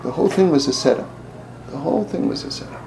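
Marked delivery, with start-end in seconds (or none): 1.7-2.8 soft, breathy whisper of the reveal. Real, not acted. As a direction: hush it like a secret. Repeated, whispered.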